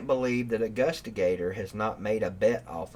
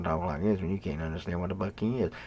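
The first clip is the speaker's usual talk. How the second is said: fast, run-together mock of a mumbling talker; unintelligible caller impression; 5 semitones lower, 3 dB quieter, faster than usual. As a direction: rush it like someone nobody can understand. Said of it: "How does he talk like that".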